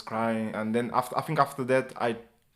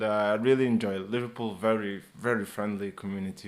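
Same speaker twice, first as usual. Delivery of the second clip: ordinary talk, nothing marked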